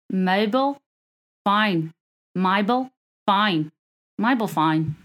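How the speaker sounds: slow put-on Australian; Australian accent. Shown as a demonstration. Host repeating the mobile phone trick, Australian accent. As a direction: try it over in mock Australian. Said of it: "sounds like you're saying mobile phone".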